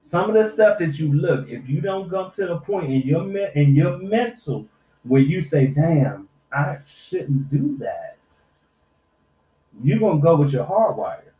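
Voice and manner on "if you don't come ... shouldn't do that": usual speaking voice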